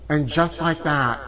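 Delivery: ordinary delivery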